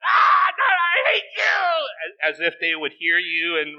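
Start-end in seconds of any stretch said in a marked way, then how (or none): 0.0-2.3 high, drawn-out mock scream; hammed-up high-pitched rage yell. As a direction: imitate a furious gamer shrieking at the screen. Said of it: "they'd actually start yelling at the T V".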